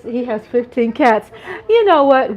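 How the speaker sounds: ordinary talk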